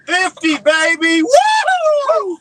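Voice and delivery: loud high celebratory yell; excited shout. Real, not acted. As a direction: cheer it out at full voice. Celebrating a fifty-pound fish.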